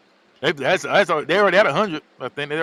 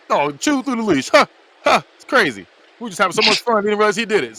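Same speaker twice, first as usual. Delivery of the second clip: nervous play-off; 7 semitones higher, 5 dB louder than usual. Retold or imitated. Playing it off, retold.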